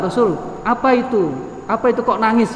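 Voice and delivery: high-pitched bewildered asking; lightly acted astonishment; puzzled question. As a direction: wonder aloud on behalf of a baffled onlooker. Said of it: "karena ungkapan keheranan ya".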